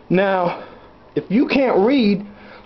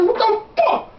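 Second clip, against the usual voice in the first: high mock outburst; 11 semitones higher than usual. Father reacting to son in story.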